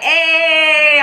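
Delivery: drawn-out, high, sing-song hey; hyped greeting. Retold or imitated. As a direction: imitate a loud, hyped-up hello. Acts out Dexter's excited greeting.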